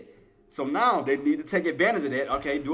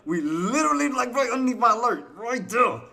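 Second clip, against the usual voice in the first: high, raised protest; exasperated disbelief; 7 semitones higher, 4 dB louder than usual. Real, not acted. Trader pointing just under the alert, high exasperated protest. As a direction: insist on it in disbelief. Frustrated at near miss.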